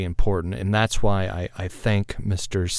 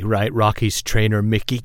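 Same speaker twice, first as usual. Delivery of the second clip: ordinary talk, nothing marked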